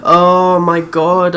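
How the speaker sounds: ordinary delivery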